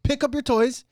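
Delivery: high, loud stern dad laying down the law; father retelling scolding his daughter; stern retold yell. Dad ordering daughter to clean.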